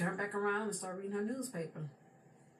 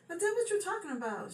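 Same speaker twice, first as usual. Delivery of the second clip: baffled; 12 semitones higher than usual. Retold or imitated. Confused by grandmother's claim.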